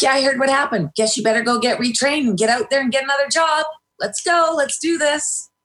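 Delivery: high-pitched, brisk imitation of pushy women; mock pushy urging. Educated women urging her to get retrained, mock pushy. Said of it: "from very educated women. It's like".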